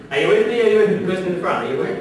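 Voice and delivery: loud check-in with the audience; calling out to room. Real, not acted. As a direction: call out to the back row.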